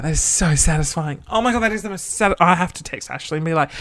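normal talking voice

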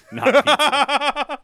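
high laughing through "not pizza". Real, not acted. Laughing joke about pizza.

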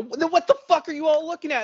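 high, incredulous demand of the gawkers; retold indignation. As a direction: act out confronting onlookers. Retold quarrel with gawking onlookers.

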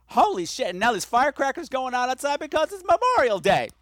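high, loud excited yelling; startled by firecrackers, yell it out; yelling into the mic. Real, not acted. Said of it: "I'm yelling into my microphone".

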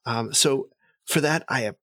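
high, eager noble voice; eager courtly elf. Acted. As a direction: gush politely at the emissary.

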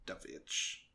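very low, hushed title reading; Werner Herzog. Acted. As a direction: murmur it deep and quiet. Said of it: "what Werner Herzog would call".

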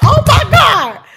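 high, loud, slow oh my God; shocked realization. Retold or imitated. Retelling the moment she recognized Rose McGowan, high and slow.

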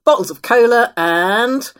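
high, loud pitch to a crowd; loud sales patter. Acted. Lucas selling snacks to the carriage, loud sales patter.

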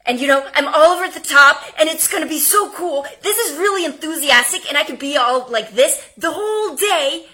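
hyped-up rapid chatter; over-the-top enthusiasm. Shown as a demonstration. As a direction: bubble over like it's all so cool. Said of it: "Because if I talk really fast".

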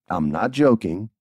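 normal talking voice

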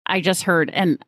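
ordinary delivery

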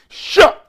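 sharp high shouted "shuh"; hype yelp. Acted. Hype chant repeated.

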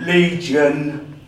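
booming out the demon's name; demon's answer. Retold or imitated. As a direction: announce it loudly, drawn out. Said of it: "And I went".

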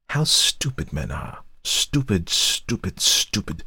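hushed, slow contempt; scornful. A narrator's reading. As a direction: spit out each "stupid" under your breath. Wife's scornful muttered repetition.

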